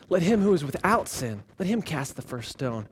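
low, hushed, measured answer; quiet authority. Acted. Jesus answering those who want her stoned, quietly solemn. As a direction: let it sink very soft and low.

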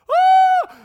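very high, very loud scream of terror; panicked scream. Retold or imitated. Documentary crew fleeing the sandstorm, screaming. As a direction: shriek and run from the sand wall. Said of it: "they're like".

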